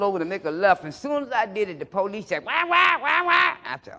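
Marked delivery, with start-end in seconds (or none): 2.4-3.6 high, loud wah-wah siren; siren imitation. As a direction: mimic the police siren.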